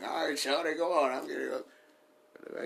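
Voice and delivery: flirty dance line.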